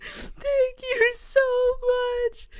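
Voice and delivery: high-pitched, drawn-out fake sob; fake crying. Driver thanking the cop for a warning, fake crying. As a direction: squeal it through put-on tears. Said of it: "fake crying".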